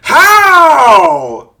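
high, loud cry of disbelief; anguished shout. Real, not acted. Crying out to viewers in loud despair. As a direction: wail the word in despair. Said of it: "I have not been this mad".

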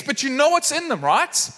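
acted, mild dismay; upset chef. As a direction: imitate an exasperated chef checking with kids. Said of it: "just getting so upset".

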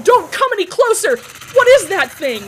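high, loud panicked yell. Acted. Garrett warding off the creature, panicked high yell. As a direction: shout him back in fear. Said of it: "Garrett yelled".

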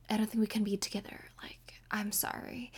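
apologetic whisper, quick then slowing; soft whisper. Retold or imitated. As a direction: whisper the letdown gently. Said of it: "now I'm like".

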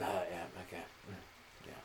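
low, barely audible rasping mumble; mock feverish chef; sick chef. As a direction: mumble like a sick cook over the stove. Sick chef coughing in food.

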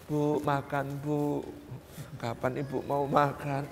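soft, low, drawn-out plea; mock tearful pleading. Son begs his fasting mother to eat, tearful pleading. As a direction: gently imitate a weepy son coaxing. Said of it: "Nangis-nangis di depan ibunya".

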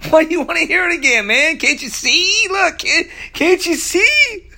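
hammed-up exasperated pleading; mock exasperation. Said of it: "he's saying".

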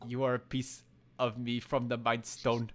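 put-on Wanda's odd, wandering accent; imitated weird accent, deliberately spoken. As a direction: put on a strange accent, imitating a film character. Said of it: "breaks that weird accent".

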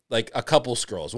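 normal talking voice

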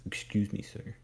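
soft, indignant mock politeness; tight-lipped indignation. Indignant fashion model accosts the director, tight-lipped and hushed. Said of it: "she barely moves her lips as she talks".

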